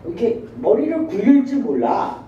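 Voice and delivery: normal talking voice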